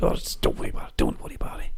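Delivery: softly hushed, night-time whisper; whispered reassurance. Retold or imitated. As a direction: soothe without waking anyone. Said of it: "And he went".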